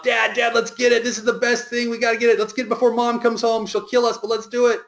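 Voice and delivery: imitating eager kids, pitched well up; excited young boys. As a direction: plead like overexcited boys in one high rush. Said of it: "They're foaming at the mouth".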